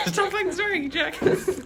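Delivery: laughing. Retold or imitated. Mocking friend's line while laughing.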